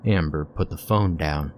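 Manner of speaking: ordinary delivery, nothing marked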